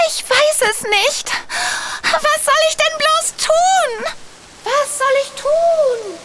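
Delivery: high, slow, near tears; lost and helpless, pleading; distressed. Acted. Lost fairy, confused by voices. (verzweifelt, den Tränen nah)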